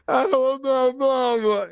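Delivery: high, wailing sobbing plea; crying for mama. Retold or imitated. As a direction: blubber like a scared kid begging for his mama. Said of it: "And he's crying".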